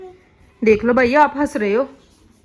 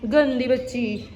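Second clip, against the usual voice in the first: fond teasing; 7 dB quieter, much slower than usual. Real, not acted. Mother teasing her toddler.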